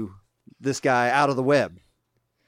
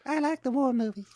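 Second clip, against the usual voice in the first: ant voice; 11 semitones higher than usual. Pretend. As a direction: squeak it out like a tiny ant. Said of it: "somebody's gonna make a ringtone out of that".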